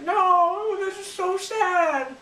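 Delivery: very high, drawn-out fake wail; hammed-up heartbroken squeal; mock distress. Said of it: "and she's like".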